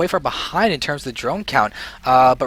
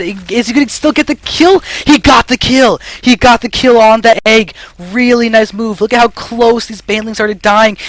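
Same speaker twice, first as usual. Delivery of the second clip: high, loud, excited shoutcasting; excited play-by-play; 7 semitones higher, 6 dB louder than usual. Real, not acted. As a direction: call the shock kill at full excitement. Caster calls a surprise kill.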